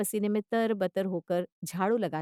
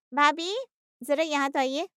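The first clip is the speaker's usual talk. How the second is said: raised, higher summoning voice; loud, pitched-up summons; calling out; 7 semitones higher, 4 dB louder than usual. Acted. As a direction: call someone in from another room. Daughter calls sister-in-law over.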